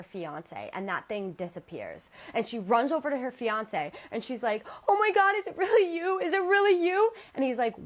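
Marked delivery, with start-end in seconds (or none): 4.9-7.1 much higher and louder frantic question; panicked. Retold or imitated. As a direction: plead in a panic, voice shooting up. Terrified girl to fiance.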